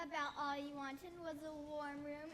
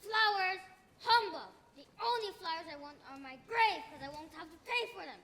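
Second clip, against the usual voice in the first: grumpy scoff; 7 dB louder than usual. Acted. Miser rebuffs the flower seller.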